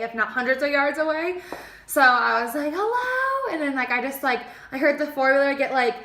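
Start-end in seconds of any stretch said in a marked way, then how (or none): 2.7-3.5 high carrying hello; calling out. Retold or imitated. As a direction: call out into the distance. Calling into the woods.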